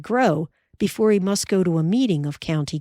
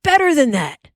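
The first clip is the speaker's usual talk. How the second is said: higher, louder teasing; playful; 8 semitones higher, 6 dB louder than usual. Acted. Pa teasing proudly.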